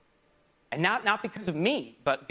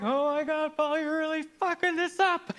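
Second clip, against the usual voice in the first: mock exasperation; 10 semitones higher than usual. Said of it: "you're both like".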